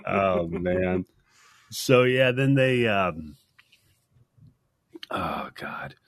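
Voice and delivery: groggy, hungover voice. Real, not acted. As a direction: drag it out like you're hungover. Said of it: "I sound like you're hungover today".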